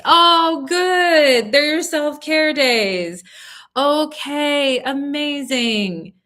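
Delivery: high, loud burst of delight; light up at the good news; delighted gush. Real, not acted. Reader gushing over client's Friday self-care.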